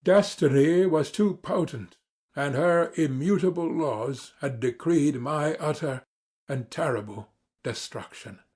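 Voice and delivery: ordinary delivery, nothing marked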